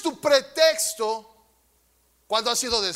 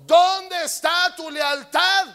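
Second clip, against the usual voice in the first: slow, loud, higher challenge to the congregation; preaching shout; 6 semitones higher, 5 dB louder, slower than usual. Real, not acted. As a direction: demand an answer from the pews. (el pastor reta a la congregación con un grito lento y agudo)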